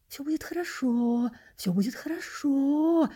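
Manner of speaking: affectionate sing-song reassurance; sweet, drawn-out lilt; tender coaxing. A narrator's reading. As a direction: coo it soothingly, drawing out the last word. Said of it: "ласково бормотал кто-то".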